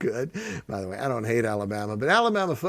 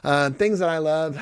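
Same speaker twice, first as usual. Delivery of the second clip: ordinary talk, nothing marked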